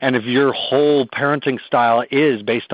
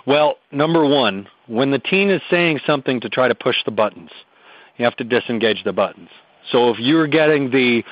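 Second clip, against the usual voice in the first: usual speaking voice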